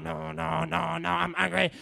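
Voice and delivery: quick, rhythmic angry refrain; spoken-word performance. Acted. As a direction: chant it on a steady, insistent beat.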